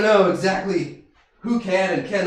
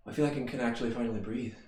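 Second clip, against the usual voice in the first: quiet reflection; 6 semitones lower, 9 dB quieter than usual. Acted. Quiet reflection after the shift.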